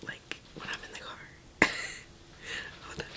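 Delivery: whispering so the house sleeps; hushed early-morning whisper. Real, not acted. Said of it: "I have to whisper right now because everyone in my house is sleeping".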